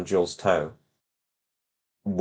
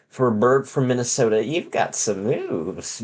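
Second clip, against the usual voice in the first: slow, lightly voiced lady bird; 4 semitones higher than usual. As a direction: drawl it out as the teasing wife.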